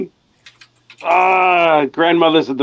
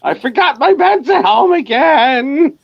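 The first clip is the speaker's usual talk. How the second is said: high slow voice; 5 dB louder, slower than usual. Acted. Sudden high, slow bit.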